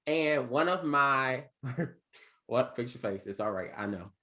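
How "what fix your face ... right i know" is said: ordinary delivery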